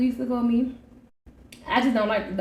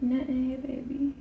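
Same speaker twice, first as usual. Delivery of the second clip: sexy phone voice; 8 dB quieter than usual. Shown as a demonstration. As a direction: coo it down the phone. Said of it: "like, a sexy phone voice".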